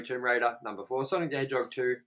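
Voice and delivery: ordinary talk, nothing marked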